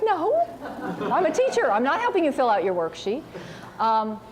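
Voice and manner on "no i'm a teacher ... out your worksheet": indignant refusal. Retold or imitated. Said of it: "And I was like".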